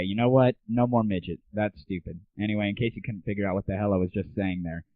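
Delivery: ordinary talk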